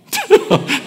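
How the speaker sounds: a short high burst of laughter. Real, not acted. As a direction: laugh once at the cheeky remark. Laughs at son's remark.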